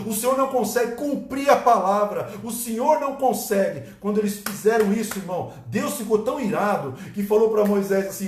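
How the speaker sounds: loud, high impassioned preaching; fiery preaching. Acted. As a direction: preach it with full force. Preaching at full force.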